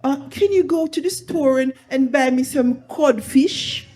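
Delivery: a hint of a put-on foreign accent; mock foreignized auntie. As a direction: imitate the auntie's borrowed accent, lightly. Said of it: "She says".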